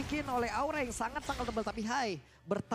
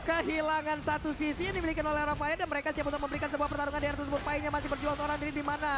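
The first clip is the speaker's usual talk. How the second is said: play-by-play commentary =29; 5 semitones higher, 5 dB louder than usual. Acted. Live esports play-by-play.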